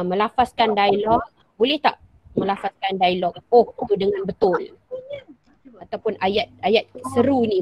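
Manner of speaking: ordinary delivery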